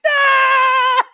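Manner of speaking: high shrieking squeal of joy; inner scream. Retold or imitated. Silent inner scream of joy at Dana's news. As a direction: shriek with delight inside. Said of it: "in my mind, I was like".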